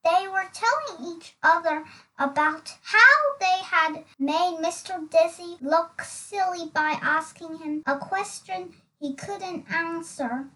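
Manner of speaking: ordinary delivery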